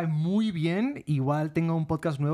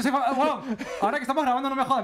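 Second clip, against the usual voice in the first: much higher mock voice of a show-off; mock boastful friend; 10 semitones higher than usual. Retelling how his famous friend bragged, high mock voice. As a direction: puff it up like a vain celebrity.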